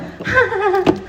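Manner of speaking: high nervous giggle; deflecting laugh. Retold or imitated. Newcomer laughing off a hard warning, high nervous giggle. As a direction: laugh it off to dodge the point. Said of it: "'cause I deflect with humour".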